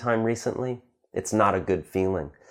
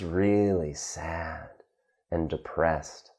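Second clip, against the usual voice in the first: slow, low and soft, sinking; sad, depressed; 6 semitones lower, 7 dB quieter, slower than usual. Acted. Teacher illustrating the opposite of happy, mock-depressed. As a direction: let it droop slowly into gloom.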